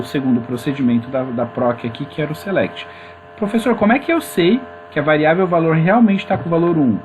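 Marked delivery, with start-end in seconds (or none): 3.4-7.0 lightly voiced student, pitched higher; student's question. Student asks the professor how to know the variable's value, lightly acted. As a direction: put a student's challenge a bit higher.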